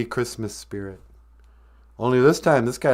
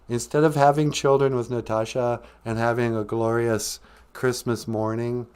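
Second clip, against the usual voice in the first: usual speaking voice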